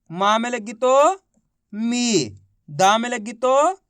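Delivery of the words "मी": मी with a long, falling ī; drawn-out long ī. Shown as a demonstration. Teacher modelling मा plus matra for class, drawn out. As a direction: stretch out मी. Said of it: "खींच खींच के इसका उच्चारण करना है".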